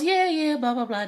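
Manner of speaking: high, loud, breezy brush-off; dismissive mock. [name] brushing off Olivia's request, breezy and dismissive. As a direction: toss it off like it's nothing.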